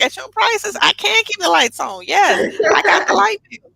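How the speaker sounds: high, loud retold retort; emphatic comeback. Business owner retells answering doubting stylist, emphatic comeback. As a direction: fire back loudly, voice lifted high. Acted comeback about keeping lights on.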